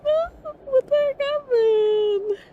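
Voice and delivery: high, slow, crying wail; horrified wail. Acted. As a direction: sob it out in shrill horror. Character breaks down in horror.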